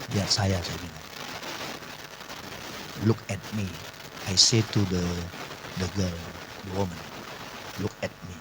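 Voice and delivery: low, hushed command; soft, low and deliberate, with pauses; quiet retold command. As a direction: re-enact a quiet, controlled order. Retells quiet command to possessed girl.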